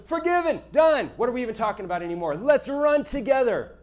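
high, bright and eager, then slowing down; God brushing the sin aside with delight; joyful God voice. Retold or imitated. God tells a sinner it is done, eager and light.

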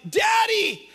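put-on high, loud kid-like shout; childish calling out. Grown man in Albertsons calling his father, childish high shout.

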